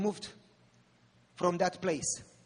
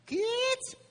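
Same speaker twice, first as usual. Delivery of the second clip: put-on high shriek of a girl's name, much higher; retold scream. Mona screams the children's name in the night, shrill high cry. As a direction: cry it out from across the building. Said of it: "we heard Mona screaming".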